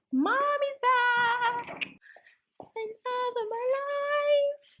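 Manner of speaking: high, drawn-out singsong squeal; gushing pet greeting. Real, not acted. Owner greeting the love of her life, high gushing squeal. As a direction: squeal adoringly, stretching the last words high.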